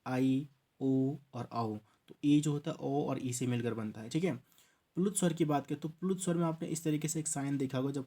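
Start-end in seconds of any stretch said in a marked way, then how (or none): 0.1-1.8 each vowel said very slowly and clearly; slow vowel demo. Shown as a demonstration. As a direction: spell out the vowels one by one for the class. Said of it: "जैसे कि".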